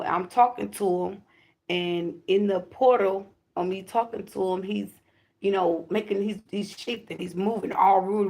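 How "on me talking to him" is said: ordinary talk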